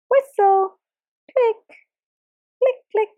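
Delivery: high clicking dolphin chatter; high playful dolphin clicks. Retold or imitated. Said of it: "hearing them trying to speak".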